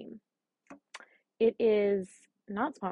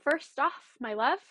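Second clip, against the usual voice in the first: usual speaking voice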